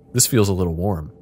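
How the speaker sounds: ordinary talk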